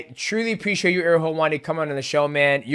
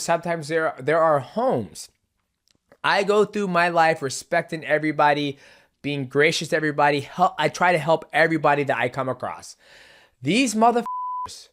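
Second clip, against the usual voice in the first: ordinary delivery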